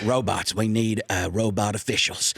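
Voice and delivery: low drawling team owner; Jerry Jones impression. Pitching robots to the NFL as Jerry Jones, low. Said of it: "It turns into Jerry Jones".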